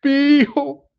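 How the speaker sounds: high, tearful pleading voice; pleading sob. Acted. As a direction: beg like a desperate father in tears. Quizás está llorando. (súplica llorosa de un padre desesperado)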